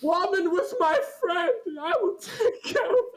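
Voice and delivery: high hammed-up yell, laughing and sobbing; a very high mock scream with laughs. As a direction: scream it like a wild vengeful friend. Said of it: "he screams".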